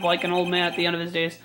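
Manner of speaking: ordinary delivery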